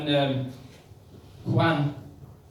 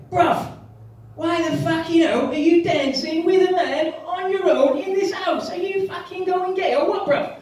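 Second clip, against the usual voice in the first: much higher, louder shouting inner voice; [name] shouting; 11 semitones higher, 6 dB louder than usual. Acted. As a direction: bark at yourself like a London lad. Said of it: "[name]'s getting agitated".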